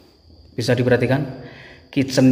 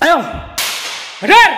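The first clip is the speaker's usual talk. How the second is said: shout; 7 dB louder than usual. Real, not acted. Calling spirits to appear.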